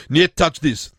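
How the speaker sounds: "niet touch this" in a mock Russian accent. Host rewriting a rap line for Chechnya, mock Russian accent. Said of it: "so instead of".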